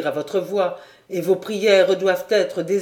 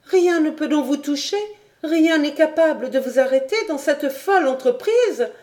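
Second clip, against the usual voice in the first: put-on higher young woman's voice, urgent and pleading; Clara pleading; 10 semitones higher than usual. Clara begging du Granec not to leave, higher pleading voice. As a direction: beg him to stay, voice lifted.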